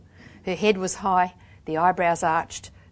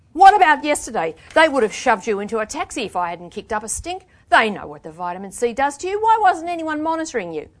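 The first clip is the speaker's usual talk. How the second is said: raised, loud, indignant questioning; angry challenge; 6 semitones higher, 7 dB louder than usual. A narrator's reading. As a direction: press them with outrage.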